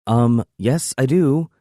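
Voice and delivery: put-on sassy girlfriend voice; eye-rolling. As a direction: roll your eyes at him. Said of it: "She rolls her eyes".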